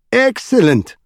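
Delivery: high-pitched exclamation of royal approval; delighted cry. A narrator's reading. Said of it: "cried the king".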